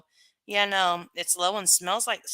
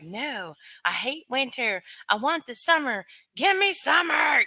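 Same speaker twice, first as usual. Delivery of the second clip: mock whiny; 6 semitones higher than usual. Mocking people who hate winter, whiny and building. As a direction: mimic summer lovers, rising to a demand. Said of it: "some people are like".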